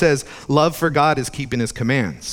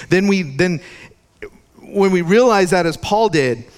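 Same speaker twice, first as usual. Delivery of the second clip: higher, louder impassioned sermon; fervent preaching; 5 semitones higher, 3 dB louder than usual. Acted. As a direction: preach it with rising fervor.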